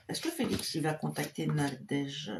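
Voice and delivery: a soft, private murmur to the tarot; hushed question to the cards. Real, not acted.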